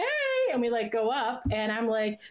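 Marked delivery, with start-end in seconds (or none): 0.0-0.5 high bright mock greeting; imitating Chris. Chris greeting the fan who drove from Canada, high mock hey. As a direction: toss off a much higher hey as Chris.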